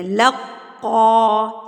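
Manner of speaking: قاف of لقا said with a heavy, doubled stop; slow recited لقا; tajweed recitation. Shown as a demonstration. Teacher showing a class the doubled قاف in لقا. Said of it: "مضبوطی سے پڑھنا ہے".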